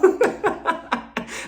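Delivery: high, breathy cackle; real laugh. Real, not acted. Host cracking up after a jab at Heather, real laughter. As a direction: laugh hard at your own joke.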